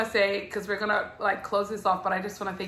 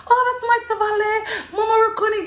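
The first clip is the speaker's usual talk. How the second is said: excited high quote; 13 semitones higher, 4 dB louder, faster than usual. Retold or imitated. Designer excitedly naming a relative, voice up high. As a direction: voice way up high, bubbly, with a laugh.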